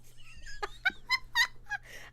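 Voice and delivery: high squealing laugh. Real, not acted. Streamer squealing with laughter after swearing.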